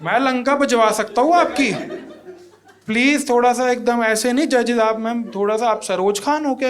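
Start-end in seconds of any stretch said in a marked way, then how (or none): none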